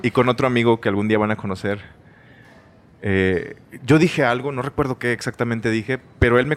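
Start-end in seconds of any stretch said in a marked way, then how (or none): none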